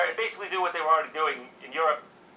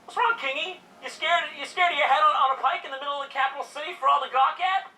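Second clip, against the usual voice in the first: mocking taunt; 8 semitones higher than usual. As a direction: jeer at the king for the crowd. Taunting the king publicly.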